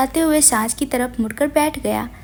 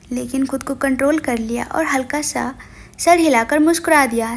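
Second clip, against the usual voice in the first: ordinary delivery, nothing marked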